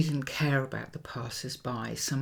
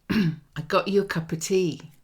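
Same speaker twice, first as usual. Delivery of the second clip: East London voice, a bit higher and louder; East London accent; 5 semitones higher, 6 dB louder than usual. Retold or imitated. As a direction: speak like a kind London cockney. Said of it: "he said in an East London accent".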